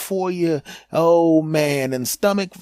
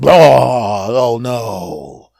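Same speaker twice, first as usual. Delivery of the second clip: pained groan; 2 semitones lower, 6 dB louder, much slower than usual. Acted. Stomach cramps acted out.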